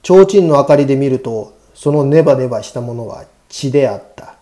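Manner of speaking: usual speaking voice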